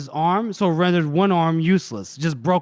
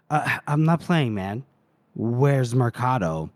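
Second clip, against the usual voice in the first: lightly voiced low menace; understated threat; 5 semitones lower than usual. Acts the interrogator questioning his victim.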